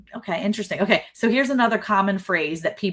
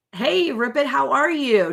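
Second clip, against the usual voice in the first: higher-pitched sing-out hello to a newcomer; effusive greeting; 7 semitones higher than usual. Real, not acted. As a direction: greet a viewer who just dropped in, pitch lifted. Greets viewer joining the live.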